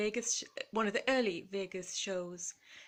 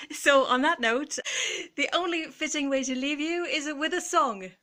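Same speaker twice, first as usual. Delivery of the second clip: louder, higher compere voice; stage introduction; 5 semitones higher, 5 dB louder than usual. Pretend. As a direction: introduce the act like a cabaret host.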